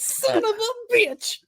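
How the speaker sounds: put-on very high, drawn-out kid squeal; imitated little boy cursing. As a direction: squeeze out the curse like an exasperated kid. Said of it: "he goes".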